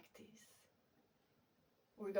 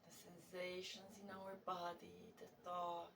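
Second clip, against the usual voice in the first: guided meditation; 6 dB quieter than usual. Real, not acted. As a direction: settle into soft, unhurried guiding, letting phrases hang.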